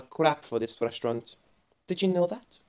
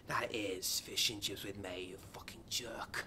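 under-the-breath whispered jab; whispered insult; 8 semitones lower, 18 dB quieter than usual. Acted. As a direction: hiss it so the customer can't hear. Waiter insulting customer under breath.

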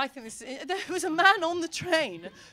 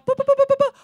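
high, loud, rapid boops on one note; imitated crossing beeps; 13 semitones higher, 7 dB louder, faster than usual. Comic beeps out the crossing signal as lights change, imitated beeping. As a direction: beep like a pedestrian crossing.

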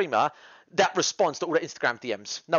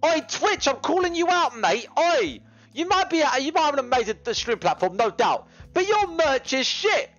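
exasperated rant; 9 semitones higher than usual. Real, not acted. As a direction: call Twitch out, half joking. Calling Twitch out, shouting.

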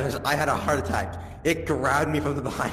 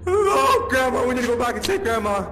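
frantic; 8 semitones higher, 5 dB louder than usual. Acted. Frantic plea to go back.

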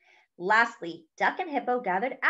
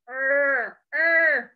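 higher, effortful groans; straining grunts; 5 semitones higher, 4 dB louder than usual. Acted. Duck trying to push the heavy cart, straining grunts. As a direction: grunt as if shoving something too heavy.

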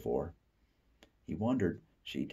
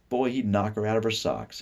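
plain reading voice

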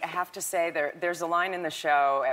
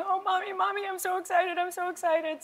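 very high, squeaky excited-daughter voice; imitated crying child. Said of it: "was crying and going".

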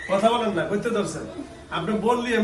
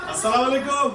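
calling greeting; 9 semitones higher than usual. Real, not acted. Greets unresponsive person after silence.

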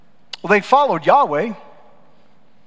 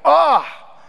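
God's frustration. Retold or imitated. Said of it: "we see God actually saying that".

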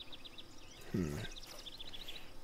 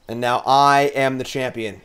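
ordinary talk, nothing marked

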